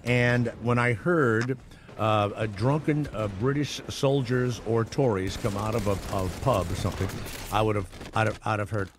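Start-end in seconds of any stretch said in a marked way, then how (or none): none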